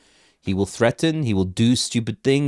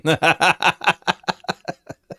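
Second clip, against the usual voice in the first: burst of laughter; 8 semitones higher than usual. Real, not acted. Laughs at own line.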